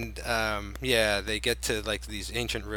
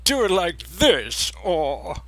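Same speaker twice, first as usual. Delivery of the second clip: theatrical, higher and louder, drawn out; Frank-N-Furter voice; 4 semitones higher, 6 dB louder, slower than usual. Pretend. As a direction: camp showman, stretched slow and grand. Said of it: "can I let out my Frankenfurter impression?".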